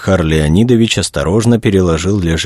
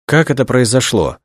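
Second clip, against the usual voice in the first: higher-pitched puzzled question; bewildered; 7 semitones higher than usual. A narrator's reading. Director asks his guests what happened, bewildered. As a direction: ask it in mild bewilderment. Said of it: "недоумённо спросил он".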